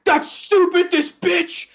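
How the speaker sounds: hammed-up whiny outburst; mock jerk. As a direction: whine it like a spoiled man-child. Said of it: "he's just like".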